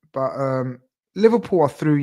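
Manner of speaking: usual speaking voice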